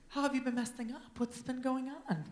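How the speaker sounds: a different voice to mark Kim from himself; Kim's voice. Retold or imitated. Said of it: "I need to differentiate her voice from mine".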